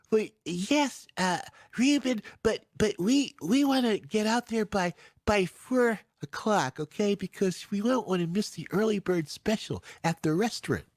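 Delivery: put-on high, fussy elderly aunt voice; aunt's voice. Aunt reminding Reuben about the early bird special, high and fussy. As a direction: do an old aunt insisting on being early.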